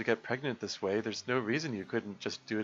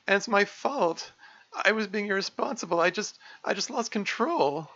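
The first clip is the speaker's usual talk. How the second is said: distressed Monica; 10 semitones higher than usual. Acted. Voicing Monica's tearful confession.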